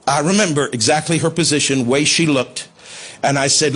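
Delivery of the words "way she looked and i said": normal talking voice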